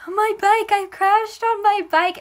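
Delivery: high, loud mock-tearful confession; acted wailing. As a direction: wail it to mom like a hurt kid. Wailing reply to mom, acted.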